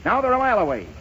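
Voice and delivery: high-pitched mock horse-race call; race commentator. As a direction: call the final stretch like a track announcer. Chancellor calls arrival like race.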